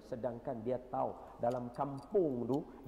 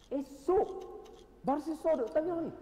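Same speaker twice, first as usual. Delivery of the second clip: much higher and louder storytelling; dramatic narration; 4 dB louder than usual. A narrator's reading. Preacher building suspense in the story of Barsisa. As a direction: build suspense for the next day.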